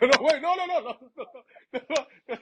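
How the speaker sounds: high, laughing string of no's; laughing no's at mom's joke; laughing protest. Retold or imitated.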